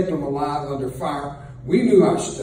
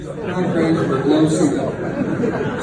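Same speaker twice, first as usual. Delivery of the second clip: below stressed and drawn out, louder; punchline repeat; 3 semitones higher, 5 dB louder, slower than usual. Shown as a demonstration. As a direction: lean on below and let it hang. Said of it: "repeats the punchline".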